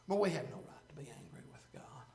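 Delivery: breathy hushed whisper; whispered conviction. Real, not acted. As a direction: drop to a whisper to make them lean in. Hushed aside in sermon.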